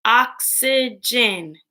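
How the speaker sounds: oxygen slowly, in three separate beats; oxygen split into syllables. Shown as a demonstration. Teacher breaking words into parts with pupils, oxygen split in three. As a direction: space out each syllable of oxygen. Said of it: "Break words into parts".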